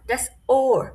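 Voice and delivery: das Ohr with the h left silent, said like oar. Shown as a demonstration. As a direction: Ohr without any h sound, just the vowel. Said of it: "এইচ এর উচ্চারণ হবে না". (das Ohr, এইচ না বলে শুধু স্বরধ্বনি)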